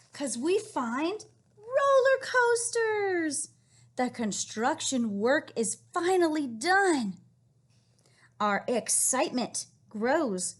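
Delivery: slow, loud building storybook excitement; excited read-aloud. Acted. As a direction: build the ride's thrill for the kids. Reading book ending.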